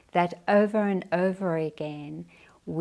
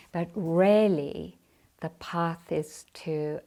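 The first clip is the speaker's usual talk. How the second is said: ordinary talk, nothing marked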